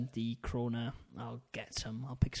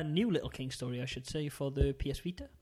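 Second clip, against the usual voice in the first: normal talking voice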